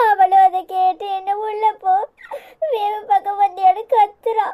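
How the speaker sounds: tearful, sobbing complaint; crying. Acted. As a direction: speak through tears, hurt. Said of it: "இப்ப எதுக்கு அழுற?". (அழுதுகொண்டே பேசுதல்)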